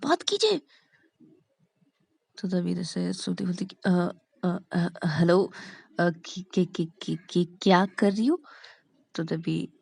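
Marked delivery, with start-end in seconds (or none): 0.0-0.6 high, urgent stage prompt; urgent signalling. Acted. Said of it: "श्रुति को इशारों में बोलते". 3.8-8.4 halting, stuck on first sounds; nervous stammer. Acted. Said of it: "तुम इतने हकला क्यों रहे हो".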